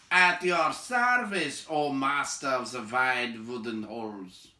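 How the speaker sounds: lightly voiced dwarves offering humble thanks; courtly dwarves. Dwarves thanking their host, slow and courtly.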